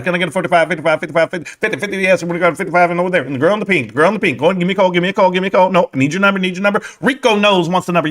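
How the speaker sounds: rattled-off, looping bid calls; mock auctioneer. Streamer playing auctioneer to imagined bidders, rolling mock auction patter. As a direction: chant the numbers as if working a crowd of bidders. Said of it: "could I ever be an auctioneer?".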